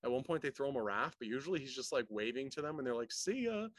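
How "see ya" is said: high, carefree mock farewell; imitated breezy goodbye. Guest stars waving to him as they leave, mock breezy. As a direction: toss it off high as you pass by.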